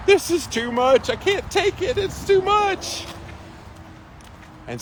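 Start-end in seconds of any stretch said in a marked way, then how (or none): 0.1-3.1 high, whiny, complaining; whiny crybaby. Retold or imitated. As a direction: whine like a big baby. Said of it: "I was a crybaby about warm water".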